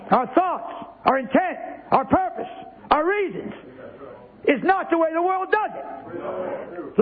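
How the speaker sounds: high, building preacher's cry; rising preaching fervour. Acted. Preaching climax.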